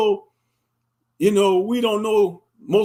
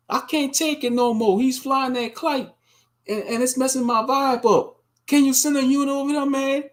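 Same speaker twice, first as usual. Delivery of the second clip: higher, whiny complaint about the kite; mock Karen; 6 semitones higher than usual. Karen calling police on a kite-flying father, higher whiny voice. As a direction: play a petty caller fed up. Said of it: "Karen see you... she gonna call".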